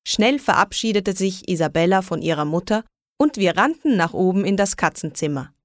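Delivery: usual speaking voice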